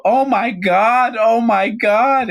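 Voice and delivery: high, loud, slow drawn-out mock shriek; hammed-up horrified inmate; shocked shriek. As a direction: squeal it out in horror at the wound. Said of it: "she goes, uh".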